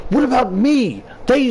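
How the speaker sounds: high, aggrieved comeback; indignant protest. Retold or imitated. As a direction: fire back in protest, pitch jumping up. Protests against imagined critics.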